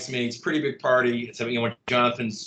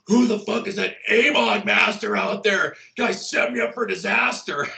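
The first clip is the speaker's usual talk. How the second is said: retold furious yell, higher and louder; mock angry rant; 9 semitones higher, 4 dB louder than usual. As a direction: ham up a jealous guy shouting from the bathroom. Guy raging from the bathroom.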